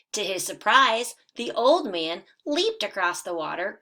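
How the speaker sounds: lively storybook read-aloud for first graders; animated children's story narration. A narrator's reading. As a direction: read it like a bedtime picture book, bright and animated. Teacher reads picture book aloud.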